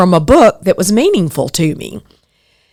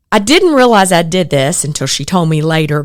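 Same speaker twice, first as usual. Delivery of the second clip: ordinary delivery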